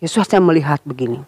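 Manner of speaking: plain reading voice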